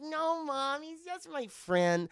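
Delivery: put-on high, slow child's protest; imitating a daughter correcting her mom; little girl voice. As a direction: insist like a small girl. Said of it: "He's like".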